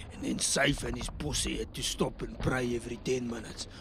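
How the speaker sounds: put-on laid-back South African accent, rough talk; South African mercenary. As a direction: tell it like a jaded South African soldier.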